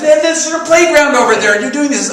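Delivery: high, loud protest; offended, retold. Storyteller scolding the golfer beside the playground, acted offence. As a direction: act out an indignant telling-off. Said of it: "I'm still very offended".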